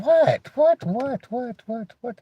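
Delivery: mock confused answering.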